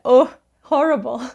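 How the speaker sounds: ordinary talk, nothing marked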